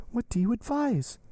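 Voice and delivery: high-pitched appealing question; Valentine, imploring. A narrator's reading. Valentine seeking Morrel's advice, imploring. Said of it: "raising her eyes to heaven and sighing".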